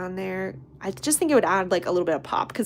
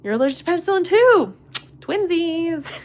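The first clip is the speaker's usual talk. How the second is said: higher, louder, fast glee; gleeful twin discovery; excited squeal; delighted; 9 semitones higher, 7 dB louder than usual. Real, not acted. Delighted at shared allergy.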